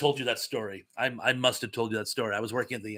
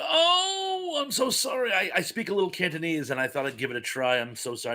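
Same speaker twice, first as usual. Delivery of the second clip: high wailed start tumbling down into quick apology; flustered mock apology; 4 semitones higher than usual. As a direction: gush an embarrassed apology, re-enacted. Retelling own flustered apology to diners.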